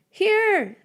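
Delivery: high, loud answering call; call back. Acted. Lost girl calls back to friend through bushes, high and loud. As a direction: shout back so he finds you. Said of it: "I call back".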